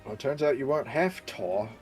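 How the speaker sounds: usual speaking voice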